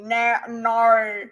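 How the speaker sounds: "no" in an exaggerated Australian accent; Aussie accent. Shown as a demonstration. Streamer following chat's advice to say it like an Aussie, Australian accent. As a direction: bend "no" into an Aussie vowel. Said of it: "Say it like an, an Aussie".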